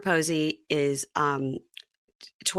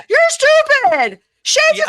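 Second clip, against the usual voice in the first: high, loud, drawn-out sneer; mock a snotty reality star jeering; mocking Kelly Dodd; 11 dB louder, slower than usual. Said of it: "It's Kelly Dodd".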